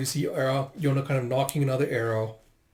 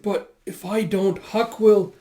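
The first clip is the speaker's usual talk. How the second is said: high, slow start rising louder; anxious plea; 7 semitones higher, 5 dB louder, slower than usual. Retold or imitated. Pleading to go back for Huck, anxious. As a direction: plead with worry.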